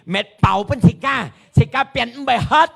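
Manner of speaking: high, loud sermon climax; full-force preaching. Real, not acted. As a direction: build to a ringing shout, hammering each phrase. (高亢有力嘅講道高潮)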